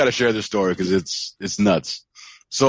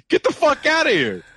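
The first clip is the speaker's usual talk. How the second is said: very high-pitched mock astonishment; amazed disbelief. Retelling the stranger's reaction to the spelling, incredulous. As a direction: squeal it in imitated disbelief.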